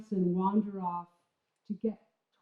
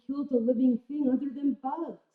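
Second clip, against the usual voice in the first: a laugh breaking through the words; 5 semitones higher than usual. Real, not acted. Narrator laughing about never killing anything, light laugh. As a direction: let a chuckle spill onto it.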